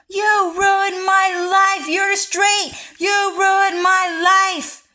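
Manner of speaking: high, loud, slow whining accusation; mock whiny yell. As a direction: whine it like an overdramatic victim. Acted quote of angry men.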